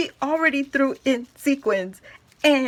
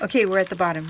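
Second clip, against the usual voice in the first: usual speaking voice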